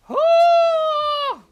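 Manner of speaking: loud high joyful shriek; high ecstatic scream. Real, not acted. To stream, shrieking over the shiny hunt, loud. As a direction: let out a thrilled scream.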